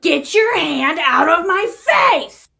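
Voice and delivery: high, very slow, emphatic demand; escalated warning. Acted. Woman repeating her ignored warning, slow and outraged. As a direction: stretch every word in acted outrage.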